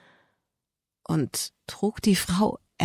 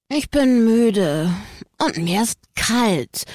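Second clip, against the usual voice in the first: put-on slow whiny little boy voice, higher; whining child; 4 semitones higher, slower than usual. A narrator's reading. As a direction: whine like a tired cold kid. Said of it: "quengelte der Kleine".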